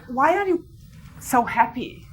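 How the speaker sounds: ordinary talk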